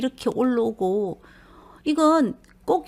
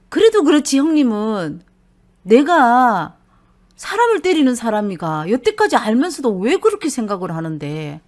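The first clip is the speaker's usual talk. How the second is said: louder hurt protest; hurt anger; 7 dB louder than usual. Acted. Husband complaining to his wife about her brother, loud hurt anger. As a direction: lay into it like a wronged husband.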